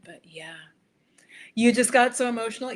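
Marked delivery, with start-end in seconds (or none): 1.5-2.7 high, loud, fast burst of emotion; choked-up excitement spilling out; emotional outburst. Real, not acted. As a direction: voice jumps up as feeling overflows. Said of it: "you saw my voice change".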